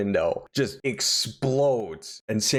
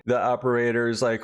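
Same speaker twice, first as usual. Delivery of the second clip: ordinary delivery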